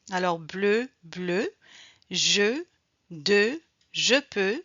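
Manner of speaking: "bleu", "je", "de", "peux" on the same rounded vowel; isolated words with a held EU; teacher modelling EU, pausing after each; French EU sound drill. Shown as a demonstration. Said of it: "Voici quelques mots avec le son".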